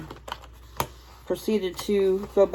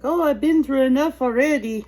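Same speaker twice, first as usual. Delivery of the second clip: higher, louder put-on doll voice; doll's complaint; 6 semitones higher, 5 dB louder than usual. Speaking for the boxed doll as it gets freed, higher and louder. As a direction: play a fed-up doll, pitched up.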